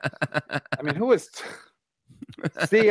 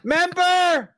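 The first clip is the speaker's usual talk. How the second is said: mocking taunt; 3 dB louder, slower than usual.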